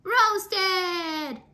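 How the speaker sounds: high, loud joyful cry; cassava cry. A narrator's reading. Cassava crowd crying out their choice to Anansi, high. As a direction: shout it out with glee. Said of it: "all the cassava cried".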